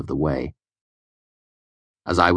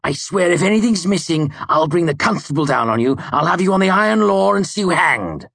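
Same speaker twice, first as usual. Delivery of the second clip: furious threat; 13 semitones higher, 6 dB louder than usual. A narrator's reading.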